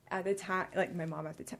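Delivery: ordinary talk, nothing marked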